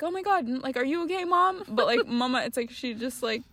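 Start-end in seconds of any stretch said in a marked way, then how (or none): 0.0-1.6 high-pitched imitation of a worried reaction; mock alarmed concern. Imitating an American kid checking on mom, mock alarm. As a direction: act out a concerned child, pitch jumping up. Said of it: "any American would have been like".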